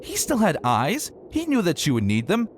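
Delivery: high, fast indignant complaining; exasperated rant. Acted. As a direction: vent at him, baffled and fed up. Dash ranting at absent husband.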